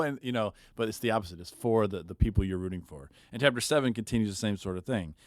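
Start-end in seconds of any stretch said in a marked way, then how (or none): none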